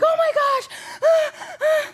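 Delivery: very high, drawn-out panicked squeal with gasps; acted panic. Mother jumping out of the car from a bug, hammed-up panic. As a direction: shriek it slowly in hammed-up horror.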